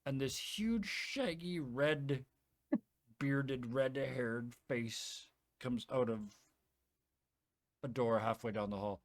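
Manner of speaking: usual speaking voice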